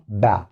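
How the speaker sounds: "با" as a short, isolated buh; phonics sound of B. Shown as a demonstration. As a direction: demonstrate "با", the B sound alone. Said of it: "حرف ال B ينطق".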